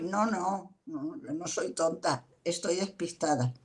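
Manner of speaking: soft, kind correction of yourself; quiet self-correction. Retold or imitated. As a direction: talk yourself down softly. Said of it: "me lo digo yo a mí misma".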